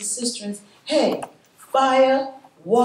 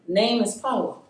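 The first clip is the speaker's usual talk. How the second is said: ordinary talk, nothing marked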